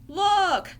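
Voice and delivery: loud, high exclamation; alarmed cry. Acted. Ernie shows Bert the trouble in the tub, crying out. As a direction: cry out to make him look. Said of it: "cried Ernie".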